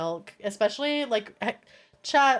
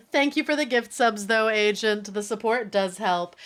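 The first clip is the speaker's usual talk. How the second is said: ordinary delivery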